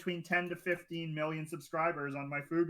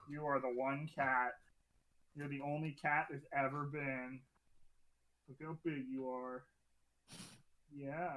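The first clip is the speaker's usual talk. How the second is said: cooing at a cat; 5 dB quieter than usual. Real, not acted.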